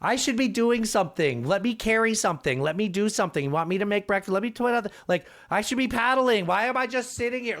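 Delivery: high, fretful mom who must help; impression of mom. Mom nagging to be useful on the river trip, high-pitched impression. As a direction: insist on helping in a high, pestering voice. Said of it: "a quick impression of my mom".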